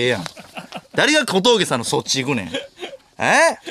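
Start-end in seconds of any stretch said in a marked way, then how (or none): 3.2-3.6 surprised cry. Real, not acted. Surprised reaction.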